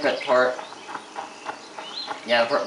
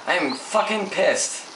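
usual speaking voice

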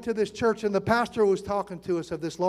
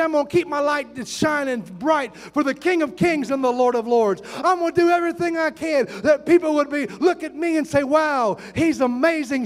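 raised, driving pulpit delivery; fervent preaching; 5 semitones higher, 4 dB louder than usual. Real, not acted. Preacher rousing the congregation to be living sermons, fervent. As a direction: preach it hard with punched key words.

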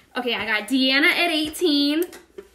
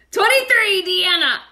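excited; 7 semitones higher, 6 dB louder than usual. Real, not acted. Excited bid calling.

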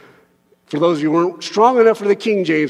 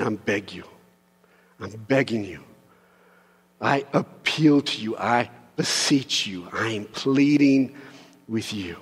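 soft, low, intimate plea; quiet earnest pleading; 8 semitones lower, 5 dB quieter than usual. Acted. Paul pleading softly with the church, quiet and earnest. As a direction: beg them gently, barely above a murmur.

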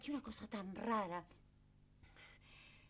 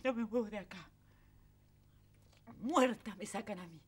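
ordinary talk, nothing marked

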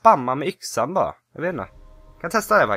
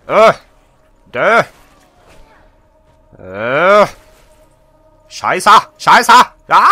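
loud frustrated yells at the enemy; battle yells; 6 semitones higher, 13 dB louder, much slower than usual. Real, not acted. Gamer fighting off zombies, loud yells. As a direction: yell out in the heat of the fight.

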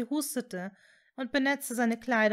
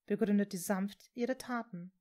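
ordinary delivery, nothing marked

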